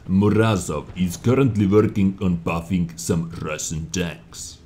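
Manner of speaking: playful put-on Russian accent. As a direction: read it in a jokey Russian accent. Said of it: "so my Russian accent".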